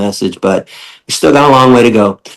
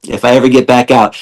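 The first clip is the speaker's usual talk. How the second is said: ordinary talk, nothing marked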